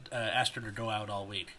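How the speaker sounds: ordinary talk, nothing marked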